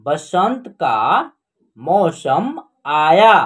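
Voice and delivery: slow word-by-word sentence reading; teacher reading slowly word by word; slow reading aloud. Shown as a demonstration. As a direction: read it out slowly for learners. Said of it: "हम direct पढ़ना सिखाएंगे आपको".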